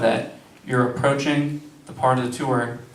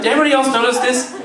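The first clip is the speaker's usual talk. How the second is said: excited gushing; 11 semitones higher, 4 dB louder, much faster than usual. Retold or imitated. Mocking eager student.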